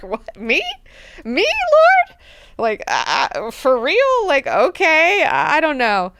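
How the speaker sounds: high, loud mock panic; scared. Mineo, scared, told he was meant to mate, in high mock panic. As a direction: squeak out nervous disbelief. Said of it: "Mineo is so scared".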